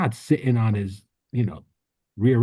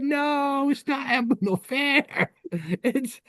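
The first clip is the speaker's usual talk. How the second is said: wife correcting; 11 semitones higher than usual. Retold or imitated. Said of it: "And she said".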